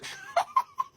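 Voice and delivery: real laugh that jumps high; high burst of laughter. Real, not acted.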